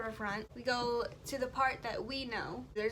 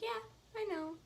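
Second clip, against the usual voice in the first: soft reply; 9 semitones higher, 6 dB quieter than usual. Retold or imitated. Her own quoted reply, soft.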